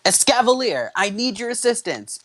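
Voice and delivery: excited high-pitched summons; summoning an ally. A narrator's reading. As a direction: call your partner into battle. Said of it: "Alder said".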